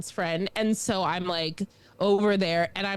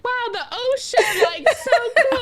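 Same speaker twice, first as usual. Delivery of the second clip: put-on high, loud, laughing kid amazement; mock wonder; 12 semitones higher, 6 dB louder than usual. Child looking out from the deck, high gleeful wonder. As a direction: gush like an awestruck child. Child self marvelling at the ocean.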